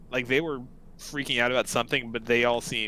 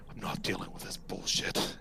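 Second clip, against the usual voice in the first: fed-up orc grumble; 6 semitones lower, 7 dB quieter than usual. Acted.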